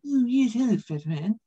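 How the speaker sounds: haughty, prim cartoon baby voice; snooty baby sneering at a grown man; Stewie Griffin voice. Comic doing Stewie greeting the fat man, haughty. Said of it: "it has to be Stewie Griffin".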